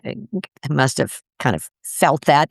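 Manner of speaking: normal talking voice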